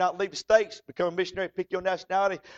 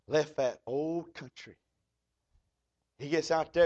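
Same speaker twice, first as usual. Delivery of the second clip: ordinary delivery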